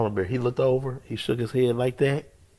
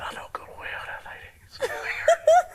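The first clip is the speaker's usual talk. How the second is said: laughing whisper. Retold or imitated. Seeing the three mothers, whispering through laughter. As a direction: whisper breaking into a high laugh. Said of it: "I said".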